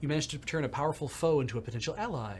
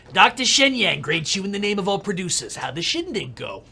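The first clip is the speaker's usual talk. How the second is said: loud brash New York producer, higher; New York accent; 6 semitones higher, 7 dB louder than usual. Acted. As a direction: play him as a pushy New Yorker. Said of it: "terrible accent".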